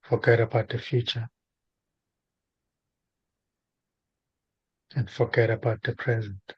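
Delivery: spaced-out phrases with long silences between; guided meditation. Real, not acted. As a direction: guide a listener gently into stillness, unhurried.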